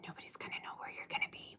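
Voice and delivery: fast secretive whisper. Pretend. Teasing secret about the future.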